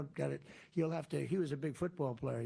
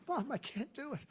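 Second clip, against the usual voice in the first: straining daughter voice; 10 semitones higher than usual. Retold or imitated. Athlete daughter straining at lift.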